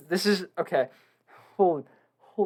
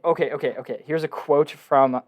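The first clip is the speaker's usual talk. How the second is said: ordinary talk, nothing marked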